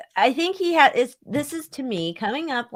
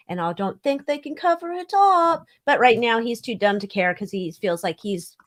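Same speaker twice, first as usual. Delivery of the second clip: ordinary talk, nothing marked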